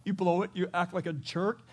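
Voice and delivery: normal talking voice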